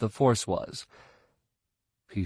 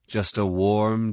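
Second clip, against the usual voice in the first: usual speaking voice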